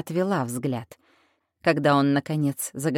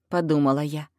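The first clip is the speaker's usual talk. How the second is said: plain reading voice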